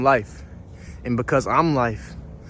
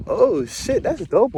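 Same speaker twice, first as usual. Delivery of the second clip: surprised; 9 semitones higher, slower than usual. Real, not acted. Spots a nice pond.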